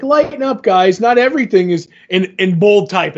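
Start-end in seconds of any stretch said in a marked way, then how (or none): 0.0-1.9 breezy reassurance. Acted. Comic book character reassuring others.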